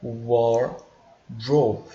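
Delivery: wardrobe with the R pulled a bit strong. Shown as a demonstration. Teacher repeats the word for the class, firm R. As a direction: stretch the R in wardrobe. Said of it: "esse R ... um pouquinho forte".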